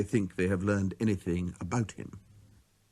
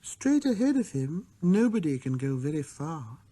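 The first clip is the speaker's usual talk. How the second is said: a hint of sadness; 4 semitones higher than usual. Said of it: "with perhaps a hint of sadness".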